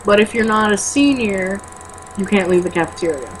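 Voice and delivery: usual speaking voice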